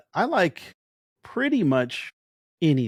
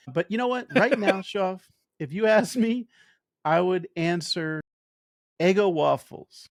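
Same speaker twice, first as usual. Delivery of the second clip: usual speaking voice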